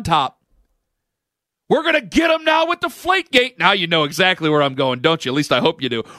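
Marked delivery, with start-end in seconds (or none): 1.7-3.6 mock hater shouting in glee; mock gloating shout. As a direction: crow it like a smug hater. Mocking Patriots haters gloating.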